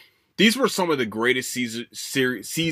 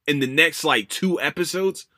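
ordinary talk, nothing marked

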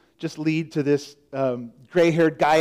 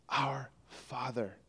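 reverent, slow; 4 semitones lower, 16 dB quieter, slower than usual. Shown as a demonstration. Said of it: "Prayer begins by hitting that pause button".

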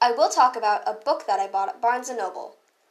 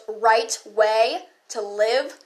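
"right way to live" drawn out very slowly and pointedly; air-quoted phrase; 5 dB louder, much slower than usual. Shown as a demonstration. Sneering at what straights call "right way to live", drawn out slowly. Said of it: "quote, end quote".

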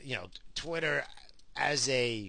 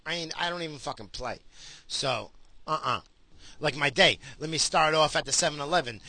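usual speaking voice

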